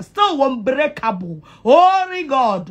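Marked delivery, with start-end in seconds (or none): 1.6-2.7 slow exclamation. Real, not acted. Drawn exclamation.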